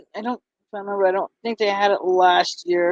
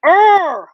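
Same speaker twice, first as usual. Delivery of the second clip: a high, loud exasperated urgh; frustrated groan; 9 semitones higher, 5 dB louder than usual. Real, not acted. Vlogger fed up with her computer, groaning loudly. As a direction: let out a burst of annoyance.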